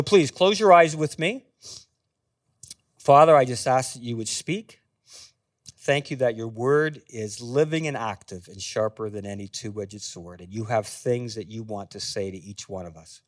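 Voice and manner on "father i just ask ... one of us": hushed, low prayer that sinks softer. Real, not acted. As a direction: pray quietly to the Father, settling lower. Leads a short prayer.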